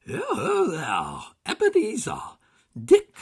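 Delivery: a fat jolly master calling out; big oily jovial shout; rich jovial call. A narrator's reading. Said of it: "called out in a comfortably oily, rich, fat, jovial voice".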